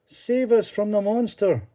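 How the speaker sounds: high, pleading cry; begging. Acted. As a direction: beg the holy man for rescue. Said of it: "they begged Columba".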